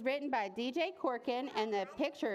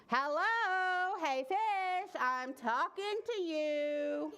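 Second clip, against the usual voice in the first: higher, drawn-out calling to get attention; insistent calling; 6 semitones higher, much slower than usual. Acted. Boy calling to a fish that ignores him, insistent. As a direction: stretch the words to make the fish listen.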